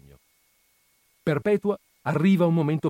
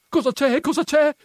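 very high, loud, insistent questioning; insistent repeated question; 7 dB louder than usual. A narrator's reading. Agnese grabbing Perpetua by the arm, loud insistent questioning. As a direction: press the question harder, pitch right up. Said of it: "ripeté Agnese, afferrandola per un braccio".

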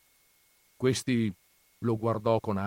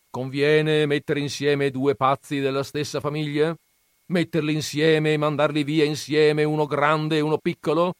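lightly voiced scornful old grandfather, raised; gruff grandfather; 7 semitones higher, 8 dB louder than usual. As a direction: scoff at the books' dreamers. Grandfather asks family. (nonno burbero accennato, tono sprezzante)

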